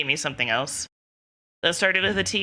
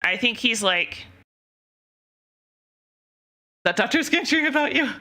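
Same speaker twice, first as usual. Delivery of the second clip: playful jokey teasing; joking; 3 semitones higher than usual. Retold or imitated. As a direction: tease her playfully, faster on the last line. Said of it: "in a joking way".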